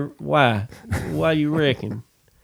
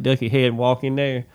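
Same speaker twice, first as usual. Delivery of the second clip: ordinary talk, nothing marked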